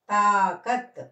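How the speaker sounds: emphatic model of తాకత్, long first vowel; pronunciation demo. Shown as a demonstration. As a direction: stretch తా, then end తాకత్ on a bare t. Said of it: "తాకత్ అని పలకాలి".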